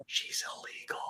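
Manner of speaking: hushed whisper, barely voiced; whispered aside. Clerk whispers to the gal beside her, hushed. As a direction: breathe it like a secret to a coworker.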